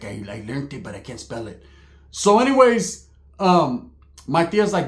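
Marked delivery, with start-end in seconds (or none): 0.0-1.6 low, very quiet cousin reply; imitated low mumble; cousin's put-on voice. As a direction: answer softly as the dim cousin. Voicing cousin's sheepish answer.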